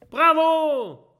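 high, loud cheer; cheering shout. Acted. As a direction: whoop it like a rowdy crew. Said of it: "rufen die Piraten".

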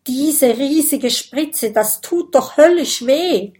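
high, loud worried complaint; alarmed protest. Acted. Dragon dreading the giant syringe, alarmed. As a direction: plead against the painful needle.

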